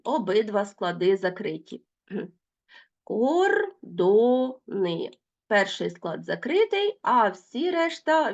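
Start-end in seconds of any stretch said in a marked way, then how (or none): 3.0-5.1 very slow, each syllable spaced apart. Shown as a demonstration. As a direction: spell out Безпідставний slowly by syllables. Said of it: "teacher splits word into syllables". (повільно, по складах)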